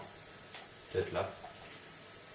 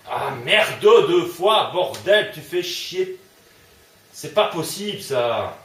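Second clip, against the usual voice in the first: angry outburst; 6 semitones higher, 7 dB louder, slower than usual. Real, not acted. Raging at the game.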